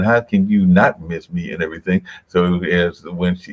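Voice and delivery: ordinary talk, nothing marked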